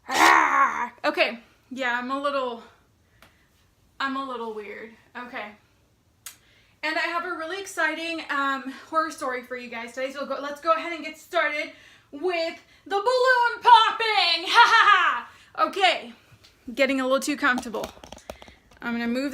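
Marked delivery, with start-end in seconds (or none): 0.1-0.9 sudden loud yelp; short startled shout; startled cry. Real, not acted. Drill locked, startled cry. 12.1-15.7 loud gleeful build into laughter; excited laughing. Real, not acted.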